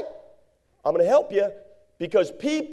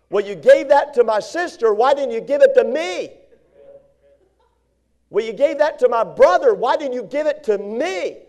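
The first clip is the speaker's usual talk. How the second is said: mock whining sibling, higher; jealous whiny child; 4 semitones higher, 3 dB louder than usual. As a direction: play the jealous kid demanding his share. Mimicking a jealous, complaining child.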